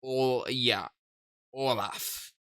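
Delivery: calm, subdued storytelling read; story narration. A narrator's reading. Reads the game's story text.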